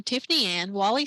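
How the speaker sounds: ordinary delivery, nothing marked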